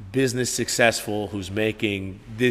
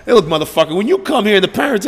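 loud, higher angry scolding; 10 semitones higher, 11 dB louder than usual. Retold or imitated. Big brother scolding the sister's boyfriend, loud and angry. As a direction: dress the kid down hard. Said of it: "I'm just scolding this kid".

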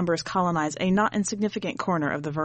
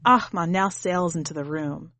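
ordinary delivery

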